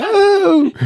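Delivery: high-pitched excited squeal; imitated squeal. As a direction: squeal like a kid on a swing. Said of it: "so she lets out a squeal".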